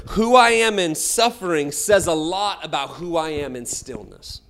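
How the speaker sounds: loud, high, building sermon cry; preaching at full force. Acted. Preacher urging congregation toward stillness, loud building preaching.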